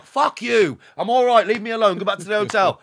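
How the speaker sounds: loud, high, reckless yelling; defiant shout. Retold or imitated. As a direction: shout them off, fearless. Defiant retort to lads from sea.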